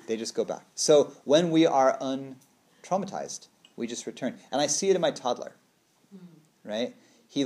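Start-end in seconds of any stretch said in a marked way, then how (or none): none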